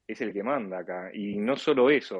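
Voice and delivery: ordinary talk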